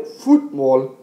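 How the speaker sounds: "fútbol" as FÚT-bol, slow and weighted on FÚT; stress on first syllable. Shown as a demonstration. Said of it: "estamos poniendo más énfasis en la U".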